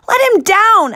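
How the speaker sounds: very high loud demand. Acted. Girl demanding Tank drop her friend, loud. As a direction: order them to let go. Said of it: "Ella demanded".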